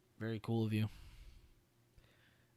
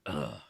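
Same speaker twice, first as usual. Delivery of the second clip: choking grunt; 8 semitones lower than usual. Real, not acted. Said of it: "I was just trying to drink water".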